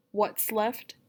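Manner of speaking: usual speaking voice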